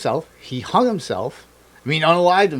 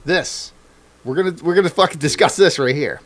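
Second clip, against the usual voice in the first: louder, higher insistent argument; mock insistence; 5 semitones higher, 4 dB louder than usual. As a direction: refuse to let it drop. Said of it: "You're just like".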